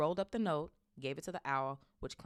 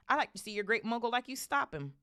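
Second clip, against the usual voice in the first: higher, louder challenge; challenging Hagrid; 7 semitones higher, 5 dB louder than usual. A narrator's reading. Hagrid daring Uncle Vernon to stop Harry going, challenging. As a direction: scoff at him, raised voice.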